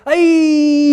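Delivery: loud, high, drawn-out "ayee"; Swami's cry of "Aye". Retold or imitated. Devotee showing how Swami reacted to the first note, imitated high cry. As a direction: wail it out in imitation. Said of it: "he did like that".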